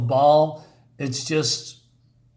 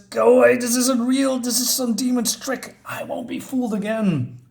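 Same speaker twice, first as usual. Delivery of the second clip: defiant Batman shout; 9 semitones higher than usual. Acted. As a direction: refuse the illusion. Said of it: "And he says".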